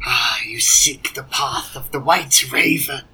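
whispered, ominous; hushed dragon whisper. Acted. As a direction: breathe it like a secret.

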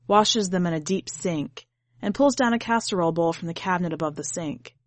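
ordinary delivery

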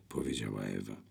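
usual speaking voice

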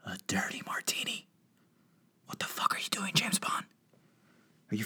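hushed, shocked whisper; incredulous whisper. Real, not acted. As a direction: breathe it out like you can't believe it. Whispered disbelief at James Bond.

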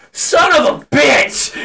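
imitated shout, high and drawn out; mock outraged holler; retold scream. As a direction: yell it slowly like mom raging at a game. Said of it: "I'm just here screaming".

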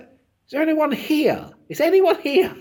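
desperate calling out. Retold or imitated. Host to an audience that gives nothing, desperate high calling. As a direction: call out high, searching for any response.

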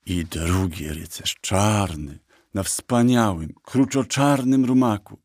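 slow, savoured list of praise; boastful admiration. Acted. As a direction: linger proudly on every quality.

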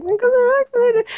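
squeaky high sarcastic retort; mock falsetto. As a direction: squeak it out like a smug joke.

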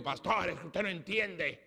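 husband making excuses to his pastor, imitated whiny and high; pleading husband. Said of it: "Un hombre me vino a mí y dijo".

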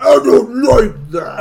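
loud, slow gruff growl; gruff Dredd voice. Acted. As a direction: growl it like a suspicious cop. Mimicking Dredd's suspicion.

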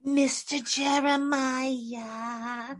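slow, soft sultry call of the name; drawn-out breathy tease; mock Marilyn. Mocking a sultry phone call with a slow soft voice. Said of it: "mock breathy birthday-style call".

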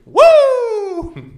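very high, loud wrestling Woo; whooping like a wrestler; Ric Flair woo. Pretend. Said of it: "I can do the flare pretty good".

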